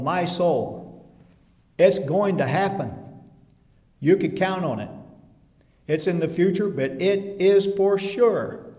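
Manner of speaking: loud, insistent sermon voice; emphatic preaching. Acted. Preacher assuring the congregation, loud and emphatic. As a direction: drive the certainty home.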